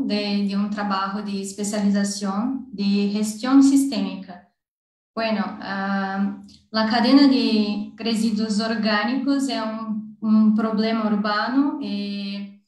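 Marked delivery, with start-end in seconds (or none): none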